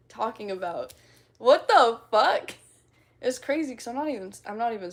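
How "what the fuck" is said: loud, slow, higher-pitched disbelief; incredulous outburst. Pretend. Streamer reacting to accent teasing, incredulous drawn-out outburst. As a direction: stretch each word out in loud protest.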